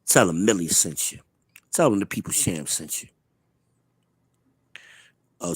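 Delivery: ordinary talk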